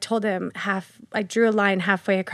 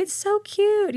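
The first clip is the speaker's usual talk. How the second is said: high, gushing Southern voice; deep Southern accent; 12 semitones higher, slower than usual. Retold or imitated. Grocery store lady admiring a customer's pocketbook, deep Southern accent. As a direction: coo it loftily high with a Southern twang. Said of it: "real deep Southern accent".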